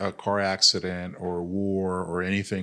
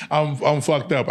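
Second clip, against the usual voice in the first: laughing; 7 semitones higher, 6 dB louder, slower than usual. Real, not acted. Joking about his hundred surgeries.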